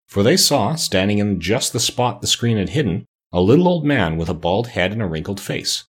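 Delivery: plain reading voice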